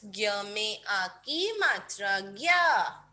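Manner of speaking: slow, careful dictation of the syllable. Shown as a demonstration. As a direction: draw out each part for kids. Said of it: "बोल बोल के लिखेंगे kids".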